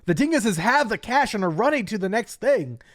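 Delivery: hyped high, loud shoutcaster call; esports commentator. Shown as a demonstration. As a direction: call it like a pumped-up caster. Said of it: "It's like an esport commentator style".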